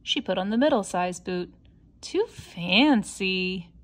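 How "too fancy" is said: slow and drawn-out. Acted. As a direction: stretch it out, picky and slow. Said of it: "she said".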